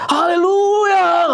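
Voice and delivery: high, drawn-out praise cry; long ringing high-pitched acclamation; mock hallelujah cry. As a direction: imitate exuberant worship. Mimics exuberant worship shout.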